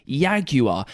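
jaguar said the native way, yah-gwar. Shown as a demonstration. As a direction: jaguar with a Y sound. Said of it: "the native word in South America".

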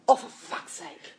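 slow, whispered, exasperated groan; exasperated mutter. Retold or imitated. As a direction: mutter it in disbelief. She groans at the surname.

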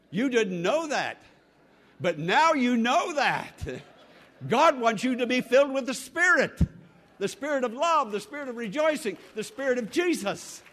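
high, loud pulpit exhortation; impassioned preaching. Real, not acted. Preacher urging the congregation toward the Spirit, impassioned preaching. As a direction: build into a ringing proclamation.

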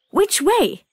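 put-on high, small childlike acorn; a tiny wondering acorn. A narrator's reading.

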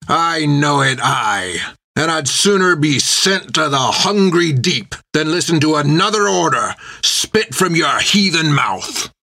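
rough, defiant prisoner shouting back; defiant sailor. A narrator's reading. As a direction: hurl it at the captain.

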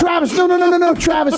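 high, rapid-fire acted denial; frantic mock pleading. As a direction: plead urgently, as if heading off a misunderstanding. Acts frantic denial to shocked friend.

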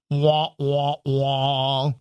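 a voiced letdown; sad trombone. Sad trombone for cloudy launch.

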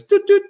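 high chirpy beep imitation; imitated scanner beep. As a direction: mimic the passport scanner going doot doot.

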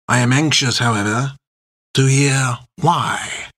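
put-on ominous French-accented captain; thick French accent. A narrator's reading. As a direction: warn them coolly in a French accent. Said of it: "a voice with a thick French accent".

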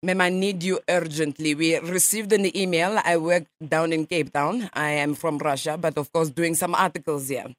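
a light mock Russian accent, nasal ma'am; radio prank caller in a lightly faked Russian accent; put-on Russian accent. Prankster calls a stranger as a Russian journalist, mock Russian accent. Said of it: "I speak Russian".

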